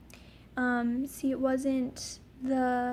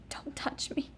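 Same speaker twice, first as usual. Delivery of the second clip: whispered warning; 6 dB quieter than usual. Retold or imitated. Pushing mom away while in pain.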